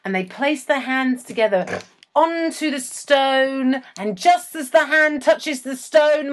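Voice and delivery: building, slowed-down cliffhanger delivery; mock suspense. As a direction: stretch out each phrase toward the big moment. Builds suspense, then quizzes co-host.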